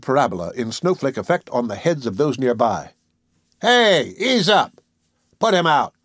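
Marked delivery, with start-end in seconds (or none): 3.6-5.9 high, raised-voice protest; indignant heckle, pitched well up; calling out. A narrator's reading. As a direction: shout out from an annoyed crowd. Annoyed diners calling out at Peter.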